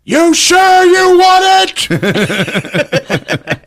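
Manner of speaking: high, loud, very slow taunt; menacing Sam. Retold or imitated. Sam taunting the cop, slow and creepy. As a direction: drag out a creepy threat.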